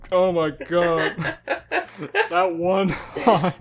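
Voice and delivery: ordinary talk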